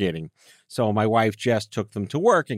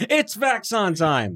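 higher, slow announcer-style catchphrase; show intro call; 8 semitones higher, slower than usual. Acted. Host kicking off the episode, announcing the catchphrase. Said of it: "show catchphrase".

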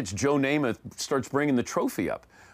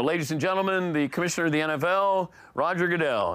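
raised, projected ceremony announcer voice; announcer introduction; 6 semitones higher than usual. Retold or imitated. Reenacting introducing the commissioner on stage, projected announcer voice. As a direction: announce it grandly to a stadium. Acting out his on-air introduction.

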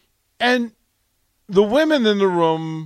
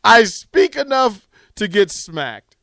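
louder, slower, higher punchline; bigger, slower second telling of the quip; punchline repeat; 8 semitones higher, 9 dB louder, slower than usual. Retold or imitated. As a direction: lean into the retold joke, slower and louder. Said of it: "as he says".

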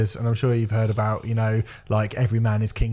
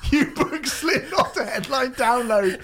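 laughing disbelief; 11 semitones higher, slower than usual. Real, not acted. Mocking the promoter while laughing.

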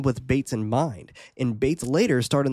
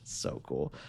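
whispering; 4 semitones lower, 12 dB quieter than usual. Real, not acted. Whispered aside.

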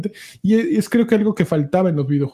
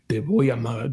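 villager voice; 6 semitones lower, 5 dB quieter than usual. Retold or imitated. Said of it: "cómo gritaban esos güeyes".

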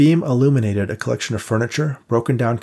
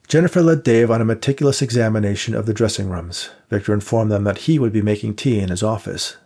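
plain reading voice

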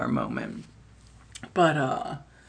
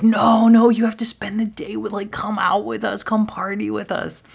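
high loud no, then a higher hushed plea; drunk party girl; 7 semitones higher, 3 dB louder than usual. Retold or imitated. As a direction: beg the driver to come party, pitched up. Drunk girls begging driver.

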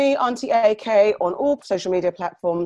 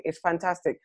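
ordinary talk

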